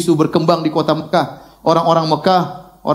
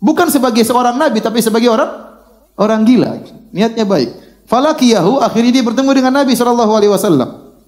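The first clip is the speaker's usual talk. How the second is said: usual speaking voice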